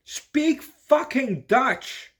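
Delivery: high, loud, drawn-out outburst; exasperated shout. Acted. Tourist ranting at Amsterdam shop staff, exasperated shout. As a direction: yell each word slowly in sheer exasperation.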